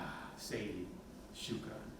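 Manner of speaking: a real quiet catch of emotion; softly emotional thanks. Real, not acted. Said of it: "Sorry, I'm getting emotional".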